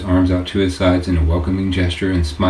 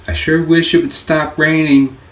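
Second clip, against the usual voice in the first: calling out higher over pouring rain; raised voice over rain; 9 semitones higher, 3 dB louder than usual. Acted. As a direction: lift the voice to be heard over the downpour. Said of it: "having to raise her voice over the sound".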